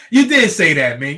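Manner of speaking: laughing while conceding he was wrong. Real, not acted. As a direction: laughs it out, loud and amused.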